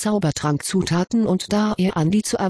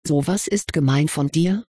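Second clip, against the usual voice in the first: usual speaking voice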